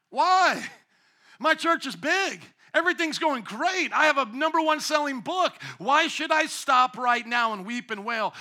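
a proud pastor objecting loudly; indignant pastor. Pretend. Megachurch pastor protesting to God, high indignant whine. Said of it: "And they're going".

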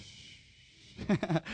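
long, soft hushing hiss; playful shush. Pretend. Preacher jokingly hushing the congregation, playful shush. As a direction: hush the room in jest.

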